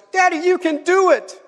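put-on high little-girl voice; imitating encouraging daughter. Daughter to Daddy frozen on the high dive, encouraging. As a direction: cheer Dad on in a high child's voice. Daughter cheering on her dad.

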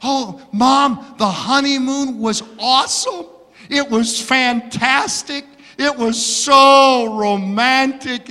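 lightly voiced dreamy bride, high and slow; a hint of a swooning newlywed; dreamy new bride gushing to her mother; gushing young bride. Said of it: "the daughter answered her mom by saying".

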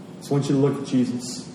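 ordinary delivery

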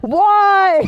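high, loud exaggerated wail of why; mock anguished cry. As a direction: cry it out in hammed-up despair. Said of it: "I'm like".

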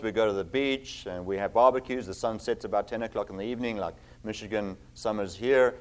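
ordinary talk

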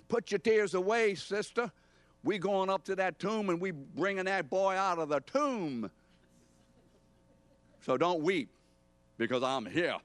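high-pitched, rousing street-preacher bravado; mock triumphant pep talk, whooped up high; swaggering Jesus. Jesus as he didn't speak to a weeping sister, swaggering hype. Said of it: "He doesn't say".

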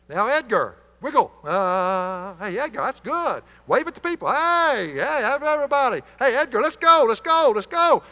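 high, loud hype-man cheering. Acted. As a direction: pump the crowd up like a cheerleader. Preacher cheers the puppet Edgar on.

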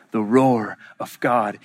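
low voice, words drawn out; slow solemn repeat. Real, not acted. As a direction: stretch out the refrain gravely. Slower repeat of the refrain.

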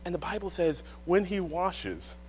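ordinary delivery

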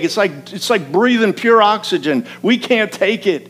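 high, loud, emphatic sermon delivery; full-force preaching. Real, not acted. Preacher telling congregation we need some sadness, fervent preaching. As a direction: drive the point home from the pulpit, loud and urgent.

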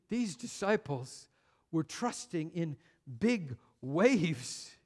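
usual speaking voice

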